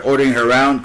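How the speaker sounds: normal talking voice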